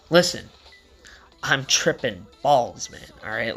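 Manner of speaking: slowed-down plea, spaced out word by word. Retold or imitated. Retold plea repeated slowly, deliberately.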